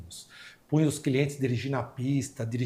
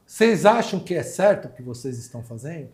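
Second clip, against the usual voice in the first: irritada; 4 semitones higher, 6 dB louder than usual. Retold or imitated. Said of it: "Ela falou".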